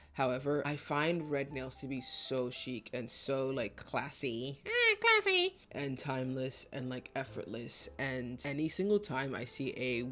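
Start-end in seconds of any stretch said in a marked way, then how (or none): 4.7-5.6 very high, loud put-on squeak; hammed-up squeal. Vlogger playfully echoing her own word, squealed mockingly. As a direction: echo your own word in a silly high voice.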